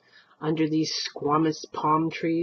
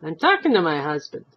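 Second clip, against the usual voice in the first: put-on dismissive old wife; 5 dB louder than usual.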